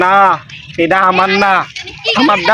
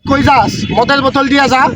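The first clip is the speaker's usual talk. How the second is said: higher shouted threats; yelling after someone; 4 semitones higher than usual. Acted. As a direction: call out angrily as they flee. Drunk yells after fleeing deity.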